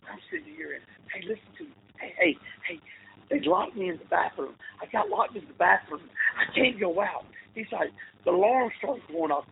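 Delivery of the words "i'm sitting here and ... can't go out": much softer, pressed and urgent, partly breathed; whisper yelling. Retold or imitated. Friend locked in a bathroom pleads on voicemail, whisper-yelling. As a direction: hiss it out like you're hiding in a bathroom. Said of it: "whisper yelling into the phone".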